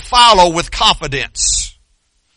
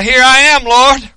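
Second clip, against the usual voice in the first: raised, higher answering call; crying out; 5 semitones higher, 3 dB louder than usual. Acted. Congregation answering God's call, crying out. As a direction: call back up to the Lord. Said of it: "you need to be crying out".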